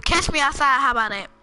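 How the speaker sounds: quick, emphatic mimicry; imitated catchphrase. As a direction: fire off the catchphrase with punch. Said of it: "he said".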